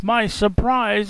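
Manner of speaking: usual speaking voice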